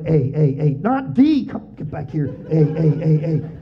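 choppy repeated letter sounds; imitated keypad typing. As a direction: tap out letters like old texting. Imitates slow flip-phone texting.

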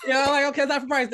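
high and loud, still chuckling; laughing. Retold or imitated. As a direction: keep laughing through it.

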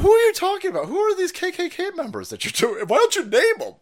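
high-pitched exasperated demand; incredulous challenge. Pretend. Host challenging the actor about KKK members, incredulous. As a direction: fire off disbelieving questions. Mock interrogation of absent actor.

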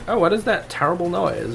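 oblivious vlogger. Acted. Vlogger hears bullets behind her, mildly puzzled.

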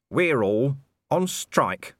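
slow, unbothered calm. A narrator's reading. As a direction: state it coolly and slowly. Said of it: "said Nobby calmly".

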